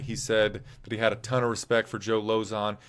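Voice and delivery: normal talking voice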